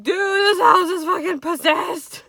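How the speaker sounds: high, loud and slow, freaked out; alarmed insistence. Retold or imitated. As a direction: insist you're terrified. Retold alarmed telling.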